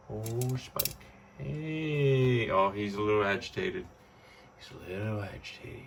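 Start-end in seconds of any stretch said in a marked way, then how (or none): 4.6-5.8 repeated much softer, almost to herself. Real, not acted. To the pet dragon, a soft murmured repeat. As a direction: say it again under your breath.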